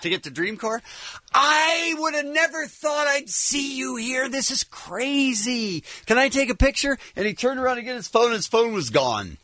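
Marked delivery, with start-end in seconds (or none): 1.3-7.0 high, slow, drawn-out amazed voice; gushing fan. Retold or imitated. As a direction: imitate an overawed festival-goer, stretching the words. Retold gushing fan meeting him.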